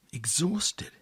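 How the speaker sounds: breathy whisper on one word; whispered exhausted. A narrator's reading. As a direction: let the word fade to a whisper. Narrator describing exhausted man.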